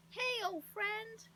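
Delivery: high, drawn-out loud greeting; excited yell. A narrator's reading. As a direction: hail a friend high and slow. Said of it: "in his excitement".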